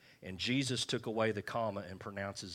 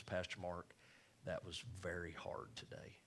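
put-on soft, quiet old lady voice, much softer; sweet old lady; 2 semitones lower, 12 dB quieter than usual. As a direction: gently voice the sweet old lady's rebuke. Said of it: "a little sweet little old lady".

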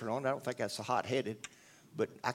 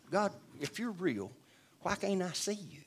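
pouting; 5 semitones higher, 4 dB quieter than usual. Retold or imitated. Retelling how he pleaded with God, higher and sulky. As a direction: whine it up at God like a sulking child. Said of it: "And I'm all pouting".

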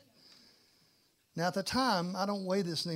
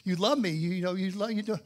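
raised, hurried bargaining with God; pleading prayer; 3 semitones higher, 6 dB louder than usual. Retold or imitated. Tired passenger bargaining with God for sleep, pleading. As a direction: plead loudly, tumbling over the words. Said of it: "I'm praying everything I can pray".

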